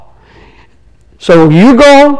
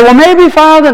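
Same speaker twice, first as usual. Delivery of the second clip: higher-pitched, wishy-washy hedging; wishy-washy prayer; 7 semitones higher than usual. Acted. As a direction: hedge it as a hesitant plea to the Father. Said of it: "wishy-washy a little bit".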